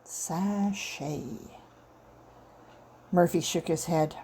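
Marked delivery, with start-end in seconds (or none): 0.0-1.2 savoured word. A narrator's reading. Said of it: "That was the only word for it".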